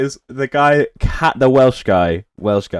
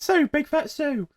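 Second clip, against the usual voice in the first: high sing-song voice; 5 dB quieter, slower than usual. Acted. Mocking the character name.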